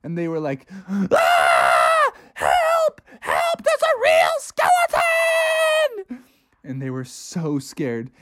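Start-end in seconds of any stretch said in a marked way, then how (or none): 0.9-6.2 high shrieking calls for help; terrified scream. A narrator's reading. Kids flee skeleton.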